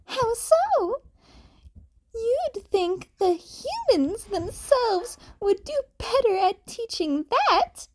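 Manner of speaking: thoughtful Nezu. A narrator's reading. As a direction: wonder aloud like a clever little creature. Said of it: "Nezu hummed thoughtfully".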